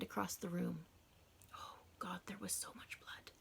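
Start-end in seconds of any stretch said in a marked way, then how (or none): 1.5-3.3 hushed, breathless whisper, slowing down; horrified whisper. A narrator's reading. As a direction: whisper it as if the sight steals your breath. Narrator horrified at the blood.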